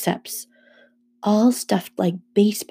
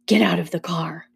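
deadly serious. A narrator's reading. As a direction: order it in dead earnest. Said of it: "in a deadly serious tone".

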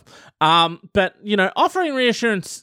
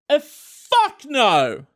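drawn out, slow uh fuck no; slow blunt refusal; 7 semitones higher, much slower than usual. Shown as a demonstration. Showing listeners how to refuse pudding, slow and blunt. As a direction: let it hang, then fuck no. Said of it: "Just like that".

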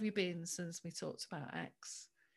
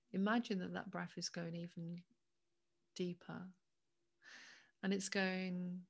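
guided meditation. Acted. Leads a breathing meditation.

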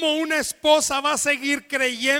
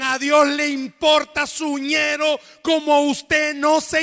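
loud, rousing sermon delivery; preaching at full force; 5 dB louder than usual. Acted. Pastor rousing the congregation, preaching loud and full force. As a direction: preach it out to the whole church.

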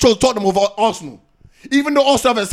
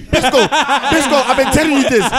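laughing tease; 3 semitones higher, slower than usual. Real, not acted. Teasing rival fan over his club.

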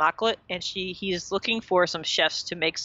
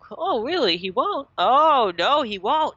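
high, loud, sing-song disbelief; hammed-up fake shock to tease; exaggerated mock surprise, rising high; mock outrage; 5 semitones higher, 5 dB louder than usual. Ross retold teasing Chandler.

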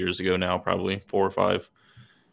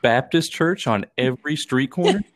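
higher, incredulous put-on voice; mock shock; 6 semitones higher, 3 dB louder than usual. Southerner up north finding no church on corners, mock shock. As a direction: act scandalized at the very idea.

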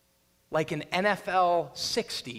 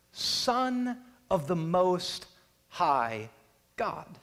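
slow, solemn title; much slower than usual. Acted.

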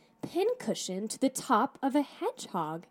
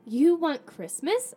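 slow, raised questioning voice; puzzled question, pitched up; 5 semitones higher, 5 dB louder than usual. A narrator's reading. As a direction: ask it in puzzlement, voice lifting.

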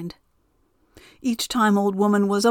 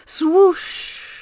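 high, louder swooshing wind; wind whoosh; 10 semitones higher, 4 dB louder than usual. A narrator's reading. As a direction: blow it out like a gust. Narrator voices the wind.

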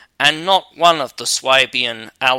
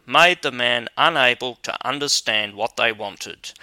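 plain reading voice

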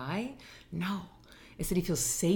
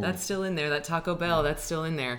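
higher, sing-song mock reminder; teasing teacher voice; 3 semitones higher, 3 dB louder than usual. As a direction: tease them playfully about what's still inside. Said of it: "and I'm like".

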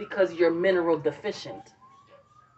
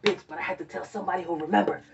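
rushed; much faster than usual. Retold or imitated. Defending early call.

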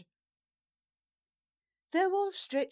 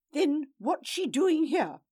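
higher, louder angry challenge; higher, louder furious question; angry demand; 6 semitones higher, 8 dB louder than usual. Acted. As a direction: demand it in anger. Angry lord confronts brother.

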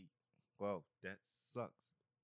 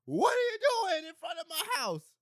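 put-on kid yelling; 10 dB louder than usual. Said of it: "the same kids out on the block".

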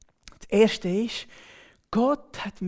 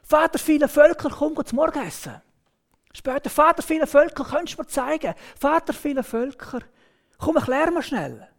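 Sarah calling him by his new name, loud; wife calling out; 5 semitones higher, 6 dB louder than usual. Retold or imitated. Sarah calls her husband to breakfast, loud and higher. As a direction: call across the house, fairly loud.

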